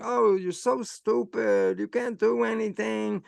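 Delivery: slow, scornful put-down of himself, lightly voiced; mock self-scolding. Alone in the lab, scolding himself: slow, lightly mocking self-contempt. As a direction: drag it out like a mild mock sneer at your own failure. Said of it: "I was going".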